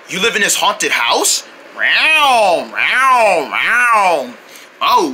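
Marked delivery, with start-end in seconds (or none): 1.7-4.3 three falling yowls in a row; repeated cat meows. Acted. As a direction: meow three times like a cat.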